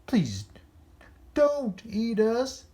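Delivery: high, slow begging whimper; pleading cry. A narrator's reading. Dogs begging the monster, high and slow. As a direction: beg for your life. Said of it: "cried the dogs".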